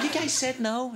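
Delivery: put-on high-pitched baffled American accent; American guy. American questions a Scot on the vote, high incredulous American accent. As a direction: mimic an astonished American, voice way up. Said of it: "an American guy".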